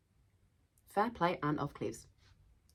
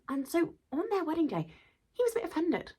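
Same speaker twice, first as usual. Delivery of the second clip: normal talking voice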